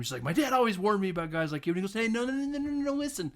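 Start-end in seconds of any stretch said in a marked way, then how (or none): 0.2-1.7 quick, louder, higher retold outburst; mock yelling. Woman turning on her suitor, quick imitated yell. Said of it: "starts yelling at him". 1.9-3.3 high, rattled string of protests; hurried pleading. Retold or imitated. Man trying to stop an angry woman, high imitated pleading. Acted pleading to calm her.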